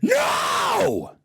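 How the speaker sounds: high, shrill-pitched screaming no. Pretend. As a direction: scream it at the top of your lungs. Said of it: "I'm just gonna start screaming".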